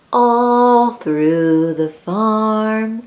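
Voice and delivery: drawn out slowly; slow refrain. Acted. Reading to a child, slow sing-song refrain. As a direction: stretch each word out.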